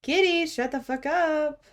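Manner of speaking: high singsong scolding of a kitty; high pet-talk voice. Real, not acted. Talking to the pet cat.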